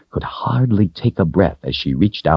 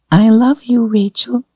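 tiny, very high doll voice, louder at the start; tiny little voice; 4 dB louder than usual. A narrator's reading. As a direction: squeak it out like a tiny talking doll. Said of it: "in a tiny little voice".

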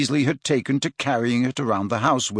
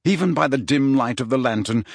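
ordinary delivery

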